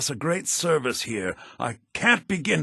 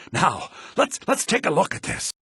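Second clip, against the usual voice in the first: ordinary talk, nothing marked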